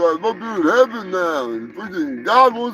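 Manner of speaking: hammed-up boxer bawling for his wife; long wailed name, then a complaint; Rocky Balboa. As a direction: play a dead prizefighter calling down mournfully. Plays Rocky calling from heaven.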